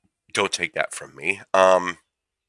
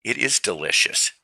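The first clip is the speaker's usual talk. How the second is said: relishing catchphrase; 3 semitones higher than usual. Acted. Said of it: "as I would say".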